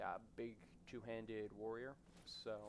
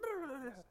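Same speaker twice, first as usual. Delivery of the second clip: laugh; 4 dB louder than usual. Real, not acted. Laughs after own shout.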